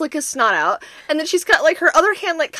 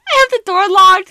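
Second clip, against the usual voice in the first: usual speaking voice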